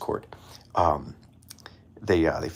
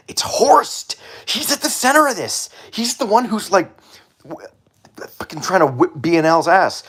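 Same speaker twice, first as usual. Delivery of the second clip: high, loud excited yell; excited shout; 11 semitones higher, 6 dB louder than usual. Real, not acted. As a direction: yell the revelation. Shouted revelation.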